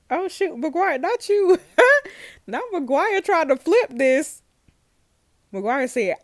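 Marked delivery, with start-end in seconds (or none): 0.1-1.6 high, loud shocked outcry; can't believe the twist, yelling at screen; shocked disbelief. Real, not acted. Gamer shouting at the villain in disbelief. 1.6-2.4 high burst of laughter; shrieking laugh. Real, not acted. Gamer laughing hard at the confession. 2.5-4.4 high, loud shocked outcry; can't believe the twist, yelling at screen; shocked disbelief. Real, not acted. Gamer shouting at the villain in disbelief.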